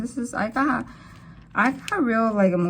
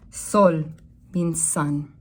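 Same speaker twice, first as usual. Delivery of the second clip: ordinary talk, nothing marked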